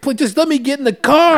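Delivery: high-pitched frantic plea; imitated desperate begging. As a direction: plead urgently, mimicking a desperate woman. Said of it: "she's begging".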